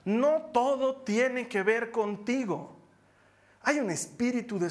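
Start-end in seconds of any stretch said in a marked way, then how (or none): 0.0-2.8 very slow, weighted "No todo tiene que ver contigo"; emphatic. Real, not acted. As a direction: press each word of the point. Sermon point repeated.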